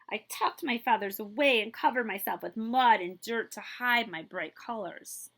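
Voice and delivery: bright, lively storybook reading; animated read-aloud for kids; reading to children. A narrator's reading. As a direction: read it like a picture book to a class.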